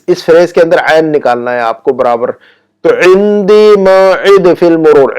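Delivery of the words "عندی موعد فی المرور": higher, slow عندی موعد فی المرور with each ain stretched; exaggerated ain. Shown as a demonstration. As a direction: overdo the throaty ain in عندی and موعد for learners. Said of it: "سکھانے کے لیے تھوڑا سا زیادہ نکال رہا".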